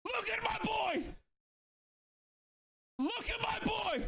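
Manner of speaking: high-pitched celebratory shout; elated shout. Real, not acted. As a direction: shout it high, stretching the words in triumph. Celebrating a goal.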